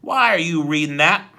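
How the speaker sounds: loud, sharp question; sharp demand. A narrator's reading. Henchman demands why Klaus reads, loud and sharp. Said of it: "he said sharply".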